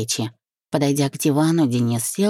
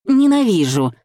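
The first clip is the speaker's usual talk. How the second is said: higher, louder exasperated outburst; desperate growl; 6 semitones higher, 5 dB louder than usual. A narrator's reading. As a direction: throw it out in angry despair. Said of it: "прорычала я в отчаянии". (отчаянный злой выкрик)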